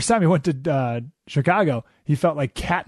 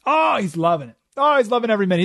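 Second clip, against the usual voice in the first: ordinary talk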